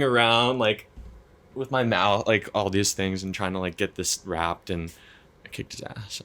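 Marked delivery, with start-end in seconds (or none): 5.4-6.2 whispered boast. Real, not acted. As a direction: breathe it out smugly. Triumphant boast, unvoiced.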